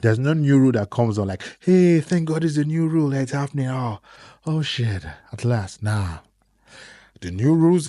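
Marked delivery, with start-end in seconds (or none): 1.6-5.8 mock joy. Imagined happy news reaction.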